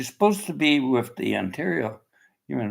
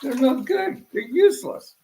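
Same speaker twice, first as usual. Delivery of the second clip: high, laughing put-down; laughing scorn; 10 semitones higher, 3 dB louder than usual. Real, not acted. Ranting about shrinks, laughing scorn. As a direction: laugh them off as useless.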